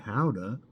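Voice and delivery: Gouda pronounced HOW-duh; locals' "HOW-da" for Gouda; local Dutch pronunciation. Shown as a demonstration. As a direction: open Gouda with an H and an "ow" vowel. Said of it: "as locals say".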